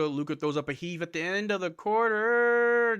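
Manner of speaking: rising buzzer-beater excitement; excited. Real, not acted. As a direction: jump on the heave like a commentator. Buzzer-beater heave call.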